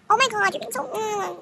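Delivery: high-pitched mock pout; whiny mimicry. Arrogant girl protests to her rival, high mocking whine. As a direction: imitate her whining, trailing into a sulky hum.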